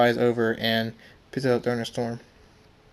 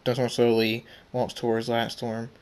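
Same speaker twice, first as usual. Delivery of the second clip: ordinary delivery, nothing marked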